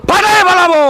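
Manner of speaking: very high, loud catchphrase shout; villain's battle yell. Acted. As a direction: scream it out as a hammed-up villain. Villain yells his catchphrase. (খলনায়কের গলা ফাটানো চিৎকার)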